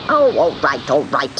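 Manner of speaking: irritated witch, cutting in; snappish witch. A narrator's reading. Sea witch giving in to the kitten, snappish. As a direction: snap it impatiently, pitch dropping. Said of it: "snapped the witch".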